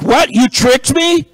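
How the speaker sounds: hammed-up betrayed shopper; indignant customer. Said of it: "the people would get angry".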